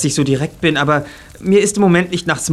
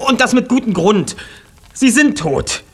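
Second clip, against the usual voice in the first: insistent; 7 semitones higher than usual. Acted. Insists parents are dead.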